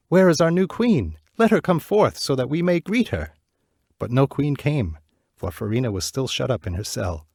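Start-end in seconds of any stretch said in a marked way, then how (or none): 0.1-3.4 higher, louder calling out; crowd calling out. Acted. Townspeople calling for their queen at the palace, loud. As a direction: shout for the new queen.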